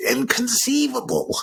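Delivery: squeaky, high-pitched outraged exclamation; mock movie quote. Fan quoting the film to co-host, high squeal of disbelief. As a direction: stretch it out in a shrill cry of disbelief.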